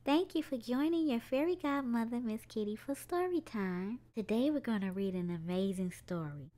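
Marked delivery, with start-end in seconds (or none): none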